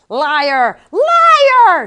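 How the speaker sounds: Liar! screamed very high and loud; shrieking. A narrator's reading. Valerie shrieking at Max from the trapdoor, very high and loud. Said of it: "shrieked suddenly".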